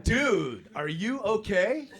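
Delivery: high, loud, drawn-out concern; alarmed question. Retold or imitated. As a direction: call out in mock alarm, rising at the end. First asking, in shock.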